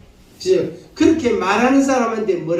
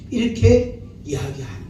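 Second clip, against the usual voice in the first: usual speaking voice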